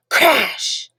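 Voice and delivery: plain reading voice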